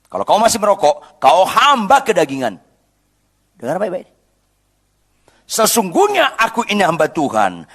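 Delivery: fiery sermon call; preaching at full force. Acted. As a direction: drive the point home to the congregation. Preacher building to a climax.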